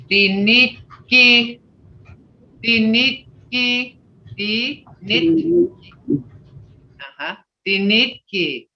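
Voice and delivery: "dinitki" said slowly, syllable by syllable; slow Muskogee word. Shown as a demonstration. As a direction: spell out "dinitki" slowly with care. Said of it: "You gotta have these sounds".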